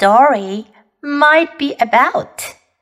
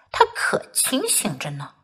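ordinary delivery, nothing marked

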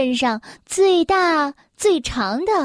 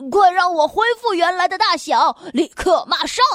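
raised, higher storybook outburst; angry shouting; 4 semitones higher, 4 dB louder than usual. A narrator's reading. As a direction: cry out demands in acted anger. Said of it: "生气地哇哇大叫着".

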